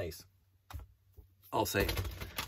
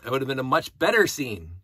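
ordinary talk

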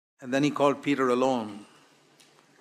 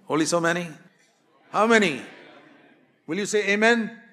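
raised, high exhorting preacher; rousing call for amen; preaching at full force; 6 semitones higher, 6 dB louder than usual. Acted. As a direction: urge the congregation with fire.

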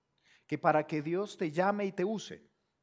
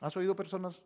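normal talking voice

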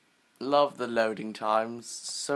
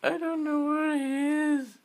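nervous. Real, not acted. Startled, looking for jaguar.

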